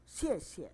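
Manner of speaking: "xie xie" said with both syllables dropping sharply; falling fourth tone. Shown as a demonstration. Teacher showing the class a sharp falling tone. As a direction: snap each "xie" down hard from high. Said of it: "Turun dihentak sedikit".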